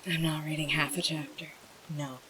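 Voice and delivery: whispered aside. Real, not acted. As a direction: murmur it under your breath.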